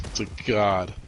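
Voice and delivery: ordinary delivery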